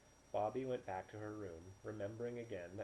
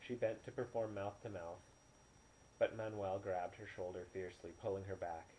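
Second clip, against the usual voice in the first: ordinary delivery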